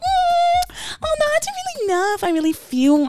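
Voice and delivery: high squeaky mock hesitation; high whiny imitation. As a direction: whine it up high, dithering. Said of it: "And you come".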